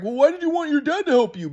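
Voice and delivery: high-pitched mocking question; mock grandpa. Grandson mimicking grandpa questioning him, high mocking voice. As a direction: ask it in a high, put-on whine. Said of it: "my grandpa's like".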